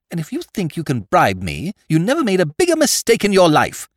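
indignant. Acted. Young lord defying a bribe.

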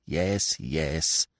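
low grumbled yes yes; grumbling. A narrator's reading. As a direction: grumble it under your breath. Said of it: "she grumbled".

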